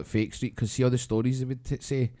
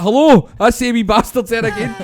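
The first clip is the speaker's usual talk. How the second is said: put-on higher, louder furious old man on the phone; mock angry phone call; 11 semitones higher, 12 dB louder than usual. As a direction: shout into a dead phone. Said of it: "It's like".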